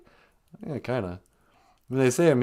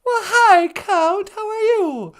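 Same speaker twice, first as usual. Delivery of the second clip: high, loud gushing hello; squealing welcome; excited greeting; 7 dB louder, slower than usual. Acted. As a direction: greet the caller with delight. Greeting the Count caller.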